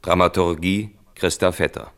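ordinary talk

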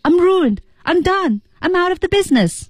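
high-pitched acted despair; emotional outburst. Shown as a demonstration. Teacher showing students the emotion behind the lines, high acted despair. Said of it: "是一个什么情绪下出来的".

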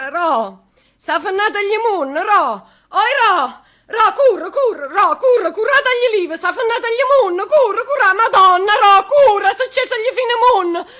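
high, loud wailing lament, half sobbing; keening wail. Acted. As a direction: wail it like a mourner at a wake. Lamento funebre, grida di zia. (lamento funebre acuto e forte, tra pianto e riso)

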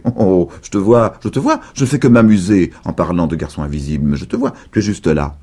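ordinary delivery, nothing marked

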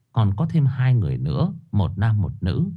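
ordinary talk

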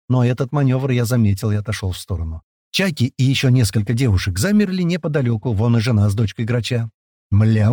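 ordinary delivery, nothing marked

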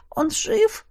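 frightened high question; anxious high plea. Acted. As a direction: ask in fear for him. Anxious question after drowning.

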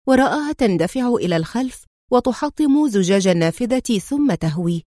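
plain reading voice

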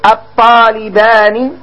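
each syllable of الطالبان drawn out slowly; slow teaching drawl. Shown as a demonstration. Teacher to pupils, drawing out الطالبان slowly. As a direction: spell out الطالبان slowly for the class. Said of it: "تقول".

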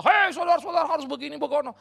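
loud, high-pitched mimicked exhortation; mock preacher shouting. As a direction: hail the congregation like a hectoring preacher.

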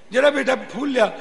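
lightly voiced guru calling to a pupil; guru asking a chore.

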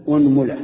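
أنملة as "unmula", u on hamza and meem; u then u. Shown as a demonstration. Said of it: "ضم الهمزة".

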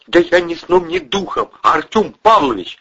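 very high, anxious protest; pleading innocence. Acted. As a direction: swear you knew nothing. Accused man pleads innocence.

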